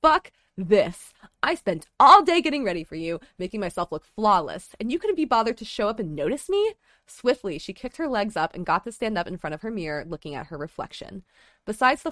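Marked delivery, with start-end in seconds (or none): 0.0-6.7 angry. Acted. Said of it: "Lumine was angry".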